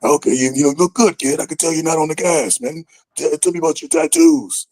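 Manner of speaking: put-on high-pitched executive voice; Johnny Laurinaitis. Talent boss sizing up a rookie in his office, imitated.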